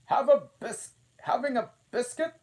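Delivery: high, loud, fast queenly offer; the Queen offering Alice a biscuit. A narrator's reading. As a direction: offer it briskly and grandly.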